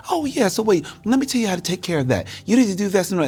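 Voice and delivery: high chatty mom voice; bright high impression of his chatty mother; imitating mom. Son imitates his mother chatting up strangers, high voice.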